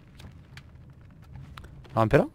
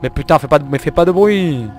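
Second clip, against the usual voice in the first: frustrated shout; 2 semitones higher, 9 dB louder than usual. Real, not acted.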